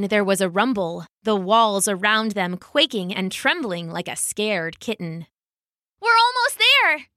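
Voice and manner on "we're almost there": high, loud eager call; girl shouting encouragement, high and loud; cry out. Acted. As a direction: call out to urge them on. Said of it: "Kaya cried".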